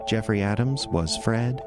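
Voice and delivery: ordinary delivery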